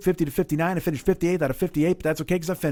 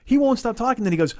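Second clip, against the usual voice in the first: ordinary talk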